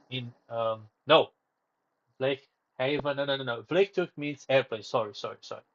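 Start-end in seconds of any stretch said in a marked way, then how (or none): none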